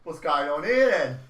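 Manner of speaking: loud Cockney bobby's greeting; hammed-up Cockney police officer; Cockney policeman. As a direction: play a Cockney copper arriving on the scene. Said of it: "Is it in a Cockney accent?".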